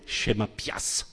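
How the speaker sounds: whispered mock little sister; imitating the sister, whispered; sister's childish claim. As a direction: breathe it out like a possessive child. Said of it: "Alors elle disait".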